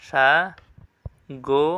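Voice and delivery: স্বাগত stretched very slowly with a rising end, as sha-got; careful pronunciation. Shown as a demonstration. Teacher showing students the right sound, slow স্বাগত. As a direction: show how স্বাগত really sounds, slowly. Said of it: "তোমাকে একটু দেখাই।".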